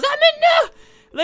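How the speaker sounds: high, loud yelp of alarm; sudden loud panicked no; startled shout. Real, not acted. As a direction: cry out as the arrows hit. Being shot at by arrows.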